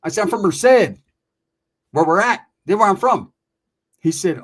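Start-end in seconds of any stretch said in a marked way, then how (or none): 0.2-3.3 loud, clear repeat; "I'm from Merced" said loud and clear for the guy who didn't hear; said louder. Retold or imitated. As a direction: say it loud so he can't miss it. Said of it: "I didn't hear that, ese".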